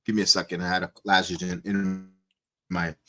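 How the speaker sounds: slightly hoarse, low and soft. Real, not acted. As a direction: talk through a bit of a hoarse throat. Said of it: "a little bit of a hoarse voice".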